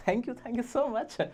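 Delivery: soft, very slow, sugary thank you; mock-polite thanks. Teacher advising students how to answer an insult, with soft slow mock thanks. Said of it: "कहना".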